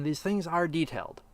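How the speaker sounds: ordinary talk, nothing marked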